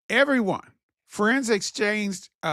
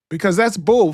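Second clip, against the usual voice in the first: louder "that's bull"; emphatic loud; 8 dB louder than usual. Real, not acted. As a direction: bite it out loud. Emphatic near-cuss.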